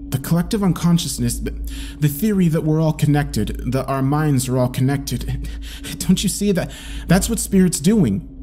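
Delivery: high, fast, breathless theory; excited. Acted. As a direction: manic excitement. Excited explanation, laughing.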